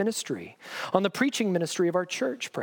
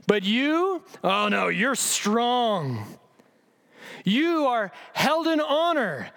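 higher, slow, sarcastic fawning; mock admiration; 4 semitones higher, slower than usual. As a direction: ham up the fake awe at their strength. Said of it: "read sarcasm".

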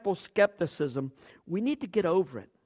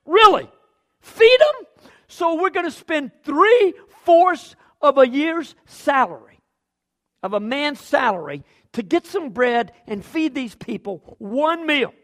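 much higher and louder, mocking incredulity; sarcastic disbelief; 8 semitones higher, 11 dB louder than usual. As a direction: scoff at the idea, then slow down the sum. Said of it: "they answered sarcastically".

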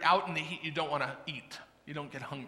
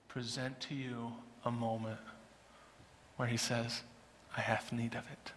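hushed sermon; 7 semitones lower, 10 dB quieter than usual. Acted. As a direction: lower the voice and draw them in.

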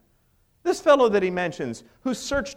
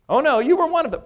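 loud, higher mock accusation; insistent accusation; 8 semitones higher, 6 dB louder, faster than usual. Girl at the fire calls out Peter, insistent loud accusing voice. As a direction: press him, sure you caught him.

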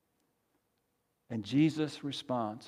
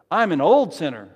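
emphatic call; 8 dB louder than usual. Acted. Leading congregation in call and response.

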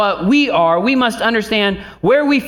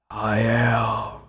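low, hushed God voice; 9 semitones lower, 6 dB quieter than usual. Retold or imitated. Preacher imitating the film's voice of God, low and hushed. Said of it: "when he does the God voice".

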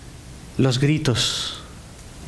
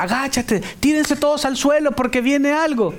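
urgent, high-pitched warning cry; acted alert shout; 10 semitones higher than usual. Preacher warns everyone to hit the floor, acted alert shout. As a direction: cry out a danger alarm. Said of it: "pero de alerta".

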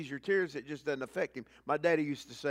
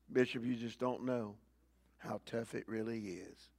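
mock complaint; 5 semitones lower, 5 dB quieter than usual. Congregant complaining to the bishop.

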